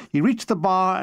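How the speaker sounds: ordinary delivery, nothing marked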